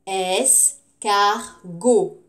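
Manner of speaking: "Escargot" very slow, es - car - go; syllable by syllable. Shown as a demonstration. Teacher repeats for pupils, slowly syllable by syllable. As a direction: separate each syllable of "Escargot" with gaps.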